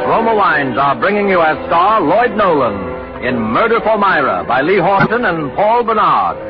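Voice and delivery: ordinary delivery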